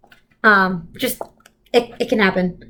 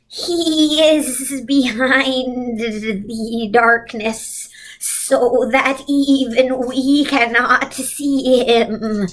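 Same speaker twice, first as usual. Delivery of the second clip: slow, drawn-out otherworldly voice; eerie slow witch voice; Missus Witch's voice; much slower than usual. Acted. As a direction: stretch every word like an ancient being.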